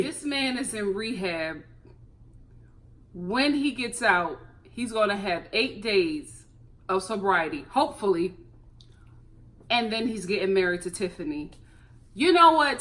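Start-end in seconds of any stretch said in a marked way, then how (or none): 0.0-11.5 deadpan. Real, not acted. Deadpan recap of bad news.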